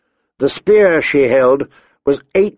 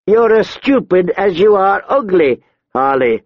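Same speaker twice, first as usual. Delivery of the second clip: high voice dropping on the name; scornful woman brigand; 6 semitones higher than usual. A narrator's reading. Tall woman scolds crude companion, scornful. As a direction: put her down with contempt.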